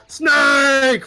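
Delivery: loud, high mock shout of Snake; quoted shout. Gamer retells the game's death cry with a loud mock shout.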